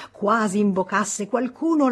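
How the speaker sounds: usual speaking voice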